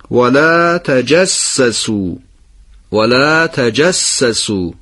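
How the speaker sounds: slow, the doubled س of تجسسوا held; slow recitation. Shown as a demonstration. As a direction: read ولا تجسسوا slowly, doubling the س. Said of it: "این فعلای نهی رو دقت بفرمایید". (آهسته با تشدید سین)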